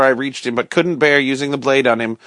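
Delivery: plain reading voice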